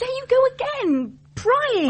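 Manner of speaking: high, loud and fed up; exasperated outburst. Acted. Woman rounds on Daniel for prying, exasperated high outburst.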